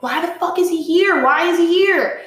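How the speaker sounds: high-pitched, insistent demand; retold distraught disbelief. Answering her friend mid-meltdown, distraught and incredulous. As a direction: re-enact the upset, pitched well up.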